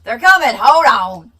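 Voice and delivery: loud, high mock proclamation; mock announcement. Telling viewers the vocal stems are coming, loud mock proclamation. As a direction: call it out like a herald.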